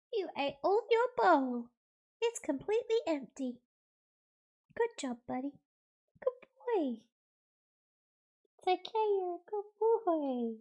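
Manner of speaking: high, cooing praise; pet talk. Real, not acted. Owner praising buddy for emptying his bowl, pet talk. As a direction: coo high at a good dog.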